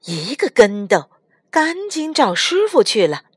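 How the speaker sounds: ordinary delivery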